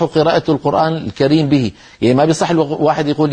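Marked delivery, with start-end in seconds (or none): none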